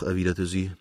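ordinary delivery

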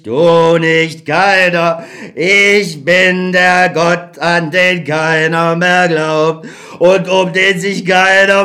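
slow, sing-song lament of an abandoned god; drawn-out, mournful and helpless; forsaken old God. Acted. God complains to Beckmann that nobody believes, slowly lamenting.

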